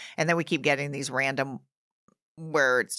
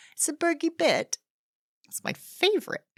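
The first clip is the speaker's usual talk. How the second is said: very high put-on British voice; mock British accent; 13 semitones higher than usual. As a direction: play it as a high-voiced Brit. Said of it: "only with the British accent now".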